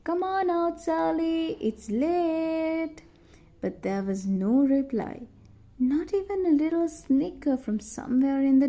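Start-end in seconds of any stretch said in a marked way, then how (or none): 0.0-3.0 yelling. A narrator's reading. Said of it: "both boys yelled out".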